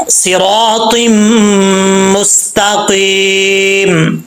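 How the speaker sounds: slow chanted recitation; Quranic recitation. Shown as a demonstration. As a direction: draw out Mustakim. Recites the verse.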